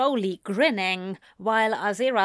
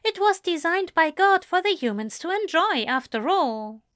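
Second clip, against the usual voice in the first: prim angel, defensive; 10 semitones higher than usual. Acted. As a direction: insist properly, as a devout angel. Voices Aziraphale in dialogue.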